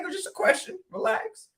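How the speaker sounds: high, soft mock-defensive; retold pushback, squeaked high and soft. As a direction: brush it off in a thin high voice. Retold exchange with aggressive client.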